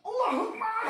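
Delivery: high piercing Allahu Akbar; high sudden exclamation; sudden cry. Real, not acted. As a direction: shout it out high to break the spell. Exorcist cries out over patient.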